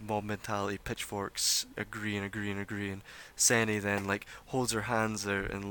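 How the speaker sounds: ordinary talk, nothing marked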